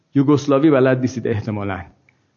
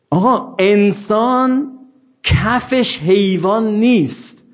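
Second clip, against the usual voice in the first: slow insistence; 5 semitones higher, much slower than usual. Real, not acted. Insists emphatically.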